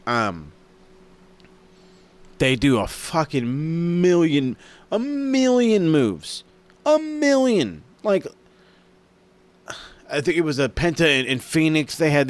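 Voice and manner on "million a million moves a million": "million" drawn out long and pitched way up; emphatic amazement. Real, not acted. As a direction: stretch "million" slowly, high and awestruck. Amazed at the number of moves.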